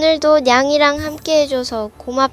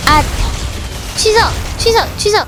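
panicked; 8 semitones higher, faster than usual. Real, not acted. Panicked cancel in game.

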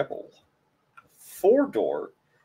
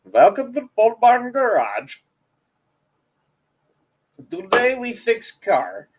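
high, hammed-up Kazakh accent; comic broken-English Kazakh voice, pitched up; Borat; 7 semitones higher, 4 dB louder than usual. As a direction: greet viewers in a mock Kazakh accent. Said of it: "Plus one vote for a Borat video".

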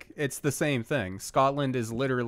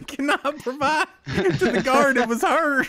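high, laughing imitation of a Southern fisherman; mock Southern accent, laughing; 13 semitones higher, 6 dB louder than usual. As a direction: tease by parroting his accent through laughter. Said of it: "I'm fitting the Southern stereotype".

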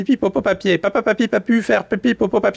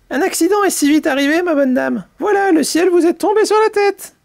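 very high, quick little voice; lecturing earthworm; 9 semitones higher than usual. Acted. Earthworm lectures the bumped mole, high quick voice. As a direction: lecture fussily in a high-pitched rush.

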